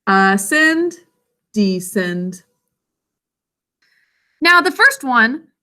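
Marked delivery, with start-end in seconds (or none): 0.1-0.9 ascend said higher and a bit louder; rising pitch for up. Shown as a demonstration. Teacher shows go up vs down. 1.5-2.4 descend dropped much lower in the voice; falling pitch for down. Shown as a demonstration. Teacher shows go up vs down.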